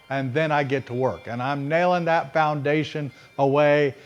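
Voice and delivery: normal talking voice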